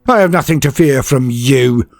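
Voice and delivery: defiant trooper; spat defiance. Acted. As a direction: spit the words at the orc. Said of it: "Bane spits".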